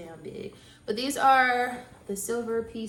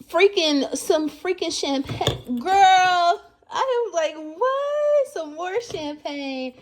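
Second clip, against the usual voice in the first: tearful excitement; 8 semitones higher, 8 dB louder, slower than usual. Real, not acted. Overwhelmed finding champagne.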